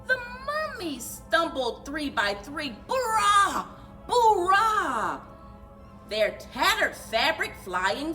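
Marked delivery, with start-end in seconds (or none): none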